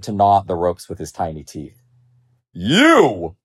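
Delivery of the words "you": loud lion outburst; angry roar. A narrator's reading. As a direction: roar the word in indignation. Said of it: "the lion roared angrily".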